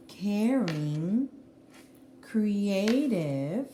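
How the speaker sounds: ordinary talk, nothing marked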